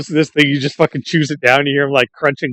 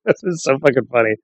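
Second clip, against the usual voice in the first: ordinary talk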